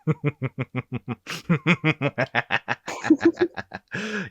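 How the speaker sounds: high cackling villain laugh; evil laugh. Pretend. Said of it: "before I finish my evil laughing".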